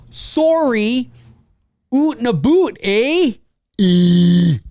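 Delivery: hammed-up Canadian "oot and a boot, eh"; loud, high mock Canadian accent. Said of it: "Could you imagine I actually talked like that?".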